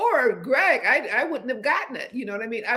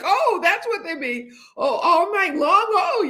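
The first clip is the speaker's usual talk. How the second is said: higher, delighted laugh through it; laughing at her own late realization; laughing discovery; 8 semitones higher than usual. Real, not acted. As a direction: giggle as the lyric finally makes sense. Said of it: "now I'm like".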